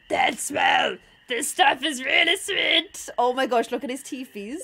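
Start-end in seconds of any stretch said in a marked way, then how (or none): 0.1-3.1 slow, growled cartoon bear; hilarious bear. Bear smelling maple syrup, delighted comical bear voice. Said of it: "That's how bears sound".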